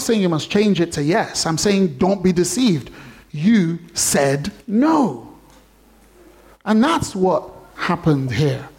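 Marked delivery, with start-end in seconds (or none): 3.3-5.3 "You said no" dragged out word by word; slow emphatic. Shown as a demonstration. Preacher confronting the congregation, very slow and firm. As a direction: stretch each word, land on "no". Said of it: "preacher drives home point".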